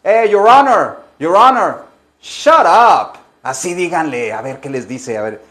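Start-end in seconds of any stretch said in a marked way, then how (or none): 0.0-3.2 más agudo y fuerte, insolente fingido; desafía al juez de mentira; le habla al juez en broma. Pretend. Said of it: "díganle al juez". (diciéndole al juez, insolente en broma)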